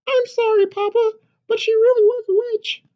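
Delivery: high, loud, eager chick's squeak; a tiny excited hen butting in; little chicken voice. Acted. Chicken bursting into papa's story, high and eager.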